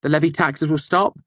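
put-on heated young prentice. A narrator's reading. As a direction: argue hotly with boyish conviction.

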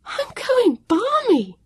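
high, slow near-hysterical cry; a girl at the end of her rope; cried out. A narrator's reading. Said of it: "she cried out".

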